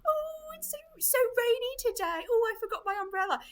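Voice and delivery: very high, chirpy mock-British voice; hammed-up happy British impression; happy British accent. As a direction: play a blithely cheerful Brit. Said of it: "you have happy voices".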